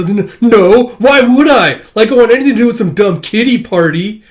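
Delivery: loud, high flustered denial; hammed-up indignant boy; tsundere freak-out. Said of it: "starts freaking out all tsundere-like".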